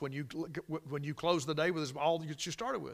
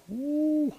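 joyful whoop; 12 semitones higher, 4 dB louder than usual. Real, not acted. Joyful praise exclamation.

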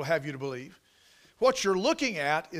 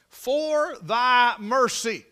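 very slow and louder, every word weighed; drawn-out quote; 5 semitones higher, 6 dB louder, much slower than usual. Real, not acted. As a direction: stretch the words out.

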